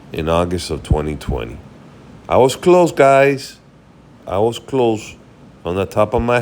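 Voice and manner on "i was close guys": pleased, higher and louder; a little victory cheer at a near-correct guess; excited. Real, not acted. Streamer checks his guess on the gold price, delighted.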